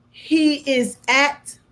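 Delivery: normal talking voice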